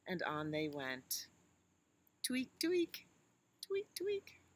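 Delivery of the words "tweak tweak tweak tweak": high, whispered little tweak noises; squeaky tweak sound. Acted. Baby elephant signalling mama with high tweaks. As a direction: tweak tweak like a tugged tail signal. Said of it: "tweak twice".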